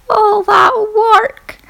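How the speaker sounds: high, slow whine of despair; hammed-up lament over wasted knitting; mock despair. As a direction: wail it high and drawn out. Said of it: "And mine was".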